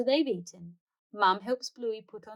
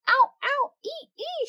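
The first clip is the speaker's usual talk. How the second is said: very high squealing yelps; yelping ow ee; 4 dB louder than usual. Acted. Girls hopping on hot footpath, squealing high yelps. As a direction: squeal as your feet burn.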